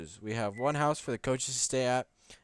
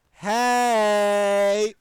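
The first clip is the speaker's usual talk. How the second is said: much higher, louder hammed-up hey; mock cheery greeting; 10 semitones higher, 12 dB louder than usual. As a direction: shout a fake-friendly hey. Reading Lewis's reply mockingly.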